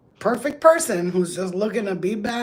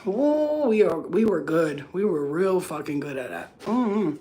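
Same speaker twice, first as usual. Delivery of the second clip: ordinary delivery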